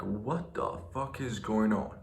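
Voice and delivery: under his breath, stunned at the scene; hushed disbelief. Retold or imitated. To girlfriend, spotting a filmed stranger: hushed disbelief.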